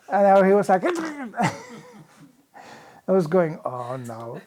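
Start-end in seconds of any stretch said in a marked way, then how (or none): 3.6-4.4 much softer, lower groan of dismay. Retold or imitated. Teller reacting to the loose teeth, soft low dismay. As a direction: mutter it in mild horror.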